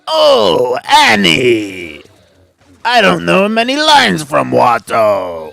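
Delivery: high, very loud alien impression; Watto. On request from a co-player, loud hammed-up Watto impression. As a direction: belt it out in a high, drawn-out creature voice. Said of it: "do a Watto impression".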